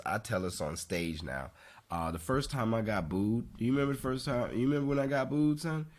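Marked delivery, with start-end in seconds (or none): none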